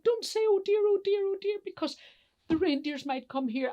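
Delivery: high, loud frantic warning; frantic elf scolding. Acted. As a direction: cut across the panic in a high voice. Kringle hushes the panicking elves.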